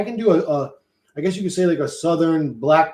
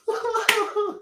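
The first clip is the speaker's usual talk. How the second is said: giggle. Real, not acted. Laughing at own accent.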